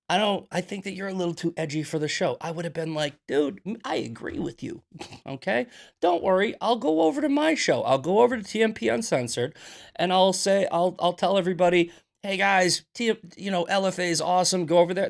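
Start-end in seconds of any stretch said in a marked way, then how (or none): 0.1-2.4 fast, higher mock quote; a light mocking take on him; lightly voiced Jeremy imitation. 3.3-15.1 higher, easygoing imagined answer; mock cheerful reply.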